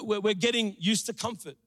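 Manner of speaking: ordinary talk, nothing marked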